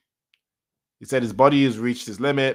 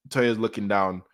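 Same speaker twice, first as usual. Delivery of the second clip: ordinary talk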